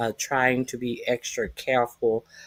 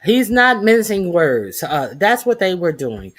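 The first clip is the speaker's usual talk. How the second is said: excited; 5 semitones higher, 7 dB louder than usual. Real, not acted. Host hyped before playing clip.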